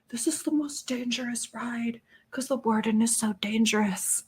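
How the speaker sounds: breathless hushed whisper. Retold or imitated. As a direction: whisper it like a secret. Kids quoted, hushed thrill.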